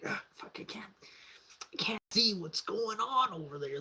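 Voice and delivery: soft, half-whispered cursing; hushed strain while spying; retold hushed frustration. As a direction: re-enact cursing under your breath. Straining to see from balcony.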